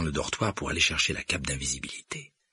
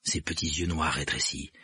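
plain reading voice

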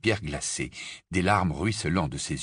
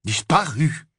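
put-on stunned boy's echo, higher; Harry echoing; 7 semitones higher, 5 dB louder, slower than usual. Harry echoes Kreacher in dismay. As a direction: repeat it in shock, joy draining. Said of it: "répéta Harry, comme en écho".

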